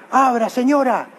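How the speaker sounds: high-pitched mock yelling at the door; exigencia a los gritos. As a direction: imitate an irate visitor demanding entry. Acts angry man at the door. (imitando gritos en la puerta, agudo)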